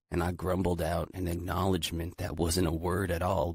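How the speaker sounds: plain reading voice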